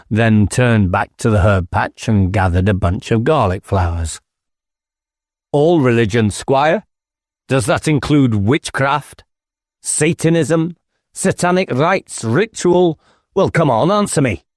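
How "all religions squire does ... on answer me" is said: higher, insistent inspector's voice; pressing inspector. Acted. Inspector pressing the squire about witchcraft, insistent. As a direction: push him, demanding an answer.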